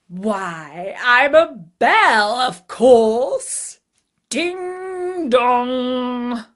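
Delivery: loud, high, slow dragon voice; gleeful dragon. A narrator's reading. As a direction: boom out the answer, then chime. Dragon gives bell answer.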